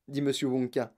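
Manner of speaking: ordinary delivery, nothing marked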